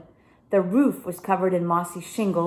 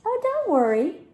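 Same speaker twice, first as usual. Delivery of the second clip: put-on high, light reassuring girl; breezy brush-off from the older girl; Vanessa voiced high; 12 semitones higher, 3 dB louder than usual. A narrator's reading.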